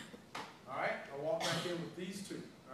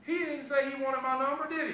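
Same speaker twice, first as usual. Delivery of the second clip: raised voice, pitched high and insistent; loud, pointed challenge; 7 semitones higher, 8 dB louder than usual. Real, not acted. Trainer challenging trainees about who asked for the number, loud and pointed. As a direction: call it out loudly to the room.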